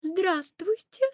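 high, slow, courteous greeting. A narrator's reading. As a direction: greet politely in a girlish voice. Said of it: "вежливо сказала Красная Шапочка".

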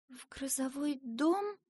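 soft, high, slow echo; stunned question. A narrator's reading. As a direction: repeat it hushed, in dismay. Asya stunned by the news.

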